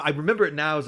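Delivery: normal talking voice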